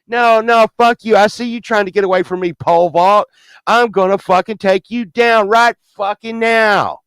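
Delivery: high, loud, aggressive threat; threatening Death. Retold or imitated. Death catching a man trying to escape, loudly menacing. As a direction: bear down on your prey, stretching the final threat. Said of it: "Death fucking came and said".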